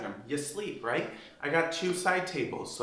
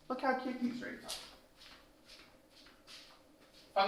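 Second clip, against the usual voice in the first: cooing over drapes; 8 semitones higher, 9 dB quieter than usual. Shown as a demonstration. To viewers, fawning over her drapes, higher soft coo. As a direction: coo it like you adore them. Repeats the line, drawn out.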